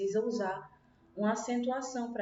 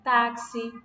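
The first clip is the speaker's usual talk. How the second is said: "táxi" with a loud, punched TÁ; stress on penultimate syllable; 2 semitones higher, 6 dB louder than usual. Shown as a demonstration. Teacher repeating an example for pupils, loud stress on TÁ in "táxi". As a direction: hit the TÁ of "táxi" firmly. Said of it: "Então, é a penúltima".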